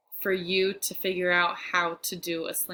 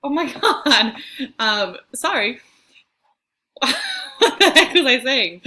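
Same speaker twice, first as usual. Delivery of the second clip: loud, high, laughing outburst; real flustered laughing; 6 semitones higher, 9 dB louder than usual. Real, not acted. Teacher derailed by Siri while recording for students, laughing. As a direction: break up laughing, thrown off track.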